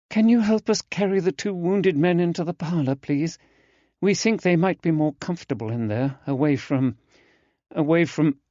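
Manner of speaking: put-on a distressed young woman asking for help, trailing off; Susanna, shaken. A narrator's reading. Susanna voiced by narrator.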